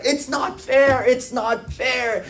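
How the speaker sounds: slow, high-pitched mock wail; drawn-out, hammed-up tearful complaint; retold crying protest. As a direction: imitate a kid bawling in protest. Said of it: "he's crying and screaming".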